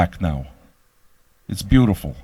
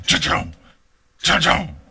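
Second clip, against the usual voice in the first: guitar crunch imitation; 5 semitones higher, slower than usual. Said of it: "Especially the cha-chung".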